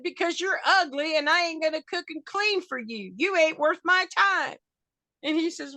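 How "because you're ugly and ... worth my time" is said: high, brash comeback; Granny trading insults with the wrestler, brash and high; sassy retort. Retold or imitated. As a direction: fire the insult back with attitude. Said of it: "I said".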